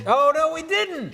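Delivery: high, loud, drawn-out exclamation; hammed-up shock, stretched out; mock alarm. Cohost asked about the Olive Garden scene, mock alarm.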